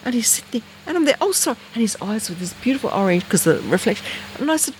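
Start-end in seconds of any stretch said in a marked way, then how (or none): 1.2-1.6 polite apology to an owl. Retold or imitated. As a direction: apologise sweetly to the bird.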